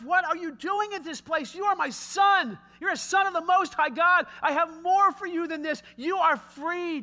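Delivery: high, loud, impassioned declaration; God's fervent call. Acted. God declaring to Jeff that he is free, fervent. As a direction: proclaim it over him with force.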